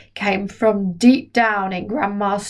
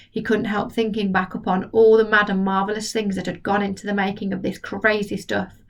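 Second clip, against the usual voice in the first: plain reading voice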